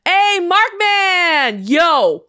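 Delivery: loud, long-drawn hail; calling out. Pretend. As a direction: holler the name as if across a room. Calls out to absent Markman.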